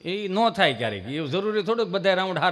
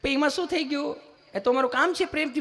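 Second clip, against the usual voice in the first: high, sweet mock-nurse voice; put-on cooing nurse; gentle nurse; 8 semitones higher than usual. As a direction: explain lovingly, pitched well up. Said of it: "તમે કેટલું પ્રેમથી પૂછો છો".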